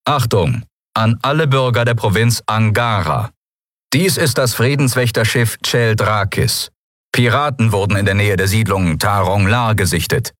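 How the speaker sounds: ship announcement. A narrator's reading. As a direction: announce it like a warship's broadcast. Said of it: "körperlose Stimme, die aus dem Artefakt drang".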